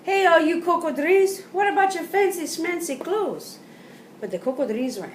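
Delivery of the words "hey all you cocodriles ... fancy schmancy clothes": high, loud call out from hiding; a child's bold holler at the crocodiles; brave shout. Acted. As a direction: shout up, mustering courage. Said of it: "Thibaut shouted".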